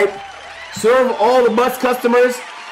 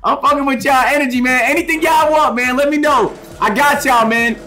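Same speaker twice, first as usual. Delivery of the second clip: hype host; 6 semitones higher than usual. Acted. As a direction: pump up the crowd like a party host. Hypes up customers at party.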